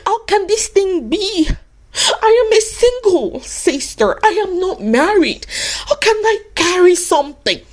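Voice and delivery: put-on high, plaintive young woman pleading; high mock-tearful protest; bewildered young Mary. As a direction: plead like a startled single girl. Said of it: "Mary also asked the question".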